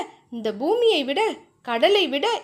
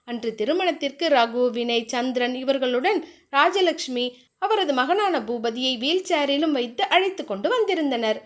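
ordinary delivery

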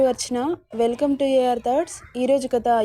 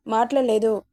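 ordinary delivery

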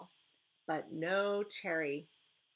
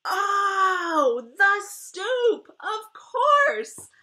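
much higher and louder exclamation; loud inner shout; 13 semitones higher, 12 dB louder, much slower than usual. Acted. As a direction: burst out in sudden realization. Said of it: "my thoughts are loud".

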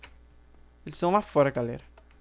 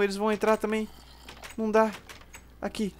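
normal talking voice

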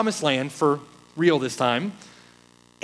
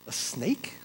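a hushed, breathy "a snake?"; whispered question; 4 semitones lower, 11 dB quieter than usual. Real, not acted. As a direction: whisper it in disbelief.

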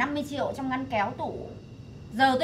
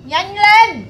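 sharp, much louder command; loud order; 5 semitones higher, 10 dB louder than usual. Acted. Mother shouting at her son to hurry up, loud.

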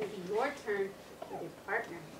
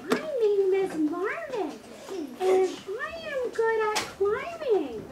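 high-pitched monkey puppet voice; Marvin; 9 semitones higher, 6 dB louder than usual. Acted. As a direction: speak up loud and high as a funny puppet. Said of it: "So Marvin says".

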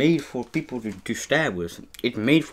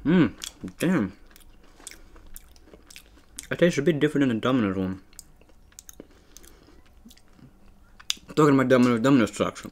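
talking between bites; chewing while talking. Real, not acted. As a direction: mouth partly full. Seen 1: Holds bitten cookie, chewing between bites while talking throughout.

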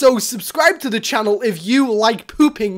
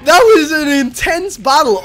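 excited; 6 semitones higher, 4 dB louder than usual. Real, not acted. After the win.

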